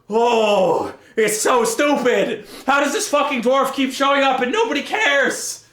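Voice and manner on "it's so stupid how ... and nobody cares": ordinary talk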